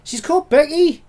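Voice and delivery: high, loud "you what?" retort; incredulous retort. Retold or imitated. Retorts as the annoyed parent.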